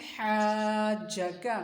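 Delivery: हाज का stretched slow; drawn-out blend. Shown as a demonstration. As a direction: join हाज का slowly. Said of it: "अब मिला के पढ़ें".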